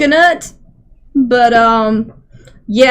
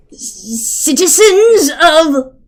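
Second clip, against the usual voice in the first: slow, drawn-out proclamation in a higher voice; grand public address; 4 semitones higher, much slower than usual. Acted. Horgamel addressing the citizens of the town, grand proclamation. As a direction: hail the crowd like a town crier.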